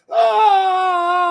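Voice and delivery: loud, high warrior death cry; Klingon death scream. Pretend. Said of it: "my Klingon death scream".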